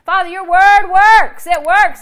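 loud, high praise shouted to God; demonstrated triumphant praise; rejoicing. Retold or imitated. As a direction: cry it out exultantly. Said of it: "I start rejoicing".

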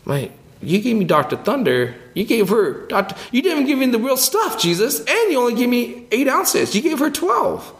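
put-on high, complaining kid voice; jealous kid protesting to Jesus; whiny Simon. Simon complains to Jesus about the small can, high and whiny. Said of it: "And I'm like".

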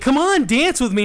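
high, loud, eager mock-wife voice; hammed-up pestering spouse; wife coaxing. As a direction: plead brightly for a partner. Imitating wife coaxing him.

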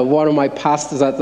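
ordinary delivery

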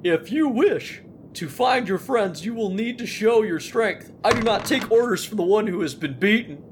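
a hint of a haughty chief; snowman leader voice. GM voices Snowgar's reply.

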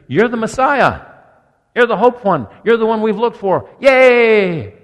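high, loud jubilant crowd; excited crowd cheer. Acted. Preacher acting out the Palm Sunday crowd, excited cheering. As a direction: cheer him like a hopeful crowd.

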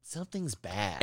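low, hushed warning; slow, soft and low like an inner alarm; warning thought. Acted. Brain warning its owner, low and hushed. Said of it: "You're just like".

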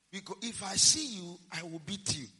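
usual speaking voice

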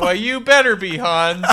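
high, loud mock warning; threatening father. Father warning Hans, loud mock menace. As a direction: warn him, hammed up. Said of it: "He's like".

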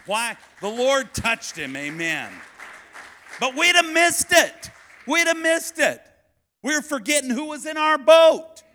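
loud, very high preacher's shout; impassioned, shouted pulpit climax; full-force preaching. Real, not acted. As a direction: drive it home to the congregation at full volume.

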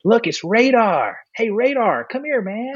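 high, sing-out mimicry of teasing kids; teasing young kids. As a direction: call out the nickname like a cheeky kid. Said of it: "these young British kids come up to me".

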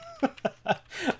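high giggle breaking out; real, uncontainable laugh; helpless giggle. Real, not acted. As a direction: lose the straight face and laugh. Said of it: "can't even do this with a straight face".

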